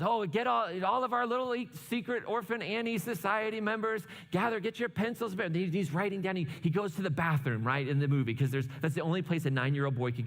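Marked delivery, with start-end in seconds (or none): 0.0-5.4 higher-pitched, mildly excited announcer call; a hint of a radio host rallying listeners; lightly voiced radio announcer. Announcer calling the society members, lightly voiced. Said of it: "Pierre then calls".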